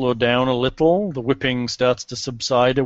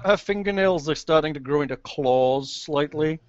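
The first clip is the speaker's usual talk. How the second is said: ordinary talk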